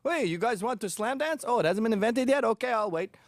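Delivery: high, hammed-up party dude; lightly hammed seventies party guy; mock partying seventies dad. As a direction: play a groovy dad who loves rock. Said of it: "he's like".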